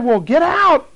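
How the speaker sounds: loud, high exorcist's command; healer commanding. Retold or imitated. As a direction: order the demon out with force. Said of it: "And the healer said".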